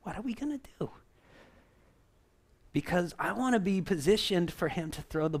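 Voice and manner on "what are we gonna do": breathy whisper, higher pitch; whispered question. Shown as a demonstration. Pastor whispers the question to the congregation, hushed. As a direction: drop to a hushed whisper to draw them in. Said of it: "This is we words now".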